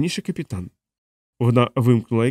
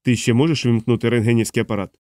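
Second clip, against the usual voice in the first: plain reading voice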